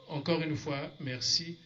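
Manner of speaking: normal talking voice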